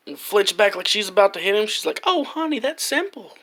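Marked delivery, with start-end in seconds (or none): 2.0-3.4 put-on high, soft sweet old lady. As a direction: soothe him like a granny. Old lady soothing boy.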